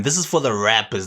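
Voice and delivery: ordinary delivery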